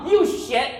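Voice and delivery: high, loud and laughing, imitated; mother's laughing insult. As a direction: mimic mom cursing through laughter. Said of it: "And she goes".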